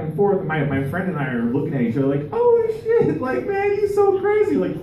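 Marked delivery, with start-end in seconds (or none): 2.3-4.6 very high falsetto aside; mock-thrilled, way up high; amused onlooker. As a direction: squeal it lightly, like a giddy bystander. Amused onlookers of quarrel, acted.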